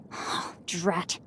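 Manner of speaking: usual speaking voice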